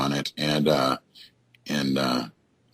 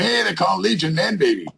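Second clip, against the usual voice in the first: mocking demon voice; 12 semitones higher than usual. Ghost hunter imagining demons teasing Legion, high mocking jeer. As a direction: jeer like a demon back in hell. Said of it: "they're going".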